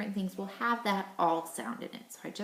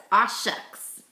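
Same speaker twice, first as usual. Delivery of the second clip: Aw said loud and long, then shucks; 7 dB louder than usual. Shown as a demonstration. Teacher cheering the class response, louder. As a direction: belt out aw shucks with glee. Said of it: "I want you to say".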